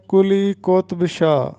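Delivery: قطب said as "qotb", no vowel between t and b; کلی قطب شاہ spoken "kuli qotb shah"; careful teacher's model of کلی قطب شاہ; correct pronunciation. Shown as a demonstration. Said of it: "یہ درست تلفظ ہے".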